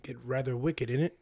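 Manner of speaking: put-on London accent. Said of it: "that was my London accent".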